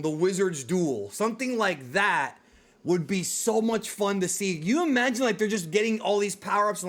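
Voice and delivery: ordinary delivery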